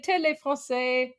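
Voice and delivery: Télé Français repeated French style; French pronunciation. Shown as a demonstration. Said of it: "repeats name".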